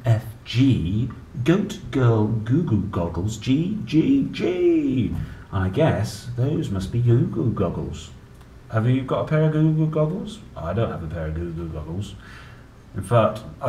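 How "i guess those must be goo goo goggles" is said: ordinary delivery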